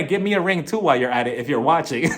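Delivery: normal talking voice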